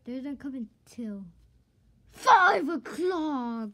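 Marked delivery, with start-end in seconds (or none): 2.1-3.7 wailing cry. Acted. Friend dismayed guests come at five, slow loud wail. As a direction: stretch it out in a howling wail.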